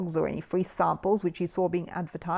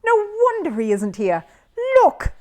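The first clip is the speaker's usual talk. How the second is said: loud, urgent pointing call; excited; 13 semitones higher, 7 dB louder than usual. Acted. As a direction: make them look, loudly. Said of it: "exclaimed Jonathan".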